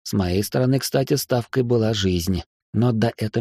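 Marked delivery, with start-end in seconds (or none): none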